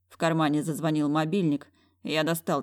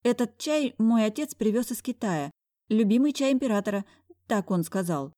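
put-on higher timid girl voice; shy girl; 6 semitones higher than usual. A narrator's reading. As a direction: keep her shy and higher. Shy secretary keeps talking.

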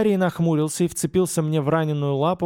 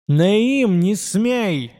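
shouted plea; 5 semitones higher, 4 dB louder, much slower than usual. Acted. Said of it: "Голос Лаима ударил по ушам".

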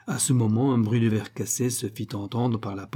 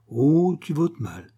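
mock reproach; 5 semitones higher, 4 dB louder, slower than usual. Mimics reproach, echoed next line.

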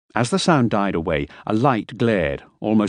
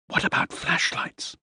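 breathy slow whisper; 6 dB quieter, slower than usual. Acted. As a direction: whisper it in the dark. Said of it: "I whispered".

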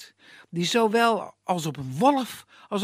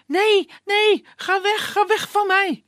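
high, loud frightened cry; frightened scream; 11 semitones higher, 5 dB louder than usual. Acted. Elena backs away from Iskander, screaming in fright. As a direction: shriek as she backs away. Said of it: "ze schreeuwt".

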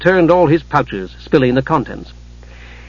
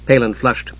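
usual speaking voice

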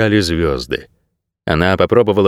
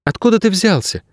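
put-on high-pitched young heroine voice; girl's question; 10 semitones higher than usual. A narrator's reading. Girl to a strange dog, breaking the tension, high questioning.

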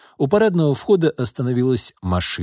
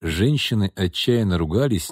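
ordinary delivery

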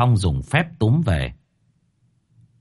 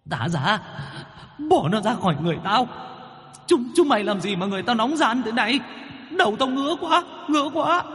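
ghost wails much higher as the itch climbs; whimpering ghost; 13 semitones higher, 5 dB quieter than usual. Acted. Tormented ghost whines at its captors, rising higher and higher. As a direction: squeal out the pain of the snake inside. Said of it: "Con vong thì ú ớ".

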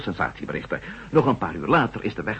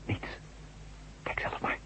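soft tense whisper; 13 dB quieter than usual. Acted. Matt whispers to the doctor as he tries to scratch it, hushed whisper. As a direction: breathe the words out unvoiced.

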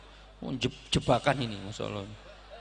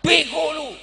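high, loud mock-angry yell; angry shout; 11 dB louder than usual. Shown as a demonstration. Preacher demonstrates coarse angry language, shouted insult. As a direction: hurl the insult at full volume. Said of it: "Harus teriak".

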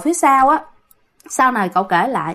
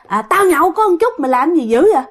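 put-on drunken yelling; 7 semitones higher than usual. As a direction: threaten like a drunk father losing his temper. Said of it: "ông la làng lên".

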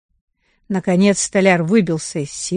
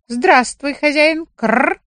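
higher rooster voice with a rolled кррр; cocky rooster; 7 semitones higher, slower than usual. Acted. Rooster greets the carpenter, higher and perky.

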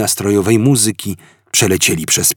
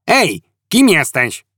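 higher, slow penguin-like quack of a voice; 7 semitones higher than usual. Acted. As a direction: quack the question. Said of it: "zakwakał".

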